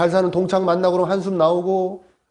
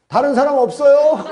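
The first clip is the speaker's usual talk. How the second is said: high, raised mock call; comic calling out; 7 semitones higher, 4 dB louder than usual. Stranded pastor rejecting God's answer, comic high call. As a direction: holler upward for another rescuer.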